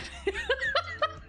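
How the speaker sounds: high giggle; startled laugh. Real, not acted. Streamer scared by forgotten alert, laughing. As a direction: break up laughing after a jump scare.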